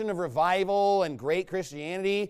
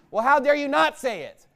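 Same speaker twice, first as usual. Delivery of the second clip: louder, higher preaching comeback; indignant retort; 5 semitones higher, 5 dB louder than usual. Real, not acted. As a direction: throw the accusation back forcefully. Preacher throws the objection back.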